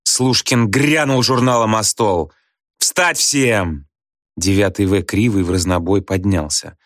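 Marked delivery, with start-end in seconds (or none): 2.8-3.9 drill-like call to attention; commanding order. A narrator's reading. Teacher slams journal on desk, commanding. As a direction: order the whole class up.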